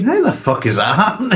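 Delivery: baffled. Retold or imitated. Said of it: "You're thinking".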